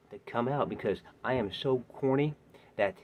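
usual speaking voice

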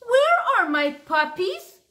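a worried dog calling for her puppies; worried dog mother. Acted. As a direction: call out for the lost puppies. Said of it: "asked the dog".

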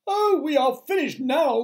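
high, loud panicked wail; shrieky spider in despair; despairing cry. A narrator's reading. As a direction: cry out that all is lost. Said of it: "cried Miss Spider".

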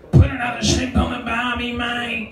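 exaggerated Australian accent, hammed up; broad Aussie cliché. Comic to the crowd, mocking tourist image, hammed Australian accent. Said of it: "absolutely cooked".

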